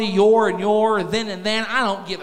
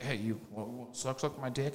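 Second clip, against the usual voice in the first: much softer, lower stammering mumble; mumbled awkward ask; 7 semitones lower, 15 dB quieter than usual. Retold or imitated. Awkwardly asking wife for head.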